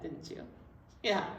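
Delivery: ordinary delivery, nothing marked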